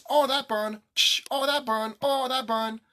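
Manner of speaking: high, whiny mock voice; hammed-up whimper of pain; mocking whiner. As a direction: mimic a stupid person complaining. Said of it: "Like this".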